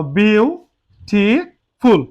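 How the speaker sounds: Beautiful very slow, as "beau, ti, ful"; split into syllables. Shown as a demonstration. Teacher breaking the word up now, beautiful split as "beau, ti, ful". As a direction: say beautiful in three drawn-out, separate syllables. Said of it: "এটাকে আমরা ভাঙাবো এখন".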